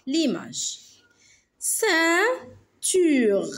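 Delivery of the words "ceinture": "Ceinture" split into cein, ture; syllable by syllable. Shown as a demonstration. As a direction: pause between drawn-out syllables of "Ceinture". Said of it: "dans la première". (« Ceinture » en syllabes bien séparées)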